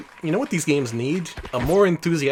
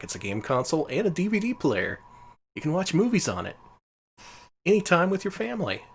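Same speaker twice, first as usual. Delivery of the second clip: higher-pitched, lightly voiced promo pitch; mock product announcement; 6 semitones higher than usual. Player retelling how the console was announced, mock promo pitch. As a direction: imitate a console advert, pitch climbing. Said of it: "they would announce alongside it was all like".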